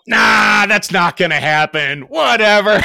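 loud, scoffing brush-off; dismissive. Retold or imitated. Pilot brushing off the chief's warning, loud and scoffing. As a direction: wave it away with a loud scoff.

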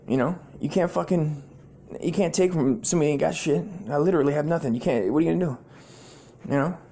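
normal talking voice

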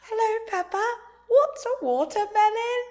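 very high cartoon British voice; put-on piglet squeal of a British accent, drawn out; Peppa Pig voice. Co-host mimics a kids' cartoon, high British voice. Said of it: "That sounded like Peppa Pig".

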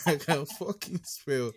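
slow boast, laughing through it; laughing while gloating, drawn out. Real, not acted. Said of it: "I've finished gloating".

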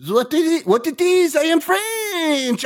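put-on French accent. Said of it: "he's probably like".

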